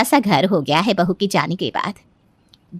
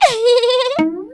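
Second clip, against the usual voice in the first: high rising giggle; silly high laugh; giggling laugh; 3 dB louder than usual. Acted. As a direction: burst into a gleeful giggle. Daughter-in-law laughs at her joke.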